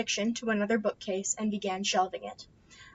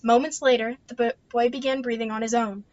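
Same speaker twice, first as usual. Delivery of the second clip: plain reading voice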